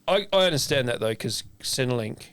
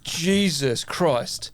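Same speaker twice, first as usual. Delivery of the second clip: drawn-out, very slow exasperated sigh of words; exasperated aside; 4 semitones higher, much slower than usual. Retold or imitated. Telling a friend about the receptionist, very slow exasperation. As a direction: let it drag out, fed up.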